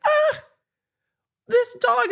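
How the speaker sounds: high mock judge sighing at the dog; exasperated judge. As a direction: play the fed-up judge with a high whine. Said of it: "the judge was just like".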